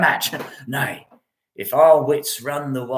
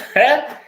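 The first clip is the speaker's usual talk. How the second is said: hearty laugh; 10 semitones higher than usual. Real, not acted. Laughs at Romeo.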